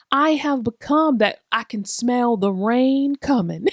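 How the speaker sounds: ordinary delivery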